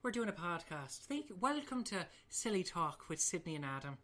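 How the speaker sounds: lilting Irish podcast introduction; Irish accent. Shown as a demonstration. Host doing the requested Irish one, Irish accent. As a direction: put on a chatty Irish brogue. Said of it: "you should do your Irish one".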